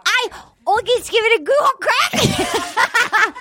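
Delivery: an Australian accent that slides toward Scottish, pitched high and slowed; a hammed-up Australian try, pitched high and drawn out; bad Australian accent. Shown as a demonstration. Host retrying her Australian accent, hammed-up and drifting Scottish. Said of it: "It's like Scottish or something".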